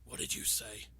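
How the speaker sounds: hushed, whispered disbelief; shocked Batman. Retold or imitated. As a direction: whisper it as if stunned. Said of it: "with this look of shock".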